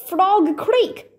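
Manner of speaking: high, hasty nervous blurt. Acted. Jack nervously blurting an answer to the centurion. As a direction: spill it out before thinking. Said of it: "Jack blurted out".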